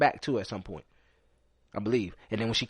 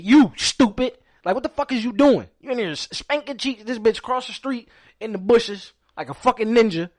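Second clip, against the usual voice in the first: higher, louder scolding rant; retold angry scolding; 8 semitones higher, 5 dB louder than usual. Brother scolding his brother for the chaos, loud and higher. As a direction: chew out your brother.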